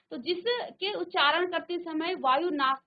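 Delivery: usual speaking voice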